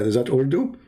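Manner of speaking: ordinary delivery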